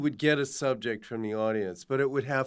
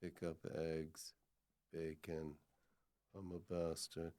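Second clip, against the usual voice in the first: much softer, grumbling half-heard to himself; muttering to himself; 8 semitones lower, 18 dB quieter than usual. Acted. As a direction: mumble the errands under your breath like a tired man.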